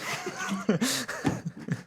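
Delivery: high, soft unvoiced giggle; a silent wheezing giggle; breathy giggle. Real, not acted. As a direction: laugh under the breath. Laughing at his dodge.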